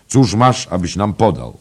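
loud, high-pitched question; called-out demand. A narrator's reading. Hungry traveller asking the innkeeper for food, called-out demand. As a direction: demand to know what's on offer. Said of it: "zawołał ów podróżny".

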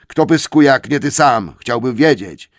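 angry troll. Acted. Tom angrily challenges William. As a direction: demand an answer, bristling. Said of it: "odparł Tom".

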